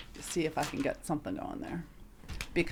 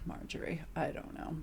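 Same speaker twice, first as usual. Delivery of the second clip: breathy whisper, giving up; whispered shrug to her friend; whispered aside; 10 dB quieter than usual. Real, not acted. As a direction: drop to a whisper in defeat. Resigned aside to co-host.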